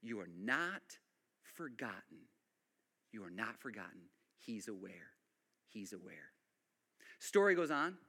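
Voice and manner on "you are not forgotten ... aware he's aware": soft reassurance. Real, not acted. Preacher softly reassuring the anxious congregation. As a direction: comfort them quietly.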